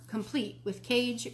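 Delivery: ordinary delivery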